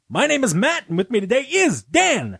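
hyped-up, high-pitched show opening; big excited welcome, pitch leaping up; high-energy host. Real, not acted. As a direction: kick off the show with huge energy. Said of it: "Matt has so much energy tonight".

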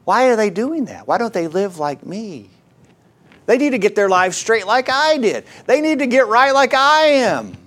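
preen about your own righteousness; smug churchgoer. Retold or imitated. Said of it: "What's implied is".

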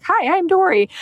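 high-pitched playful Dory voice; Dory impression. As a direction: chirp it like the forgetful fish. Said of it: "I often joke, like".

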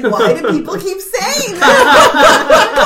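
high, slow, loud whine with a laugh; whiny Milhouse voice. Retold or imitated. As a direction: whine it like a put-upon kid. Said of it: "He's like".

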